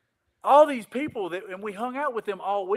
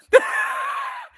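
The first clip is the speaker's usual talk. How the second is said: loud laugh; 6 dB louder than usual. Real, not acted. Big laugh after story.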